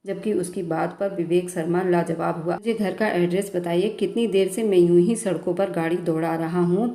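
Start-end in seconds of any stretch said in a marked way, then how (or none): none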